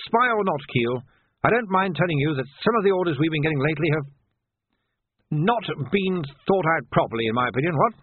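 put-on an old military man venturing criticism; disapproving old captain. Old captain criticising recent orders to sergeant, disapproving.

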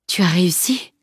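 lightly hoarse, tired question; exhausted fighter asking with a touch of hoarseness. A narrator's reading. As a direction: ask it with a slightly husky, spent voice. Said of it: "demanda-t-il d'une voix rauque".

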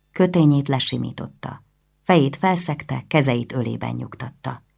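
ordinary delivery

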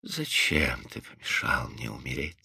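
very soft, low, drained voice; lifeless, weary. A narrator's reading. Wife asks her rescuer why, lifeless and weary. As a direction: barely voice it, utterly spent. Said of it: "сказала она всё так же безжизненно и устало".

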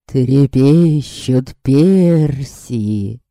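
slow, pompous verse recital; lovesick declaiming. Acted. Vampire declaiming verse at Yaska, slow and pompous. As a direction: recite it slowly like a bad poet.

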